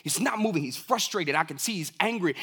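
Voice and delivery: ordinary talk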